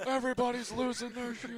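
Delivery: high, loud excited fan; excited crowd yell. Pretend. Pretend fan yelling in crowd.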